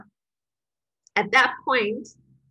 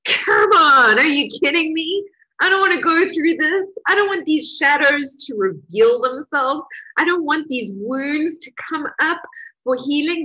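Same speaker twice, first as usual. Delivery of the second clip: louder, higher pleading whine of protest; exasperated protest; 5 semitones higher, 4 dB louder than usual. Retold or imitated. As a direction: push back against it, fed up and pleading. Exasperated inner protest at healing.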